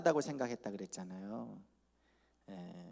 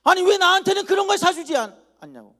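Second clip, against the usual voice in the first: shouting back at mother, much louder and higher; talking back; 15 dB louder than usual. Retold or imitated. Teen son yelling back at his mother over sneakers, indignant. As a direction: yell your grievances at your mother. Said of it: "이렇게 대들었어요".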